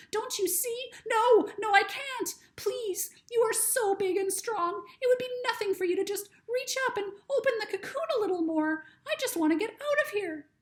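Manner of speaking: a tiny high creature, frightened and stuck; butterfly voice. Acted. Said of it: "said louder".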